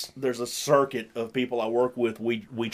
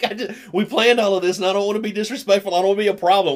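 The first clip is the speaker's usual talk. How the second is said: higher, fast apologetic whine; mock whiny new guy; 7 semitones higher, 5 dB louder, faster than usual. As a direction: parody the nervous newcomer. Mocking new guy's excuses.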